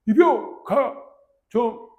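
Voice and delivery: loud, high, each syllable drawn out slowly; recruit's rank call-out. Retold or imitated. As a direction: shout it stiff and slow like a scared new recruit. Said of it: "이랬지". (겁먹은 이등병이 천천히 크게 외치는 관등성명)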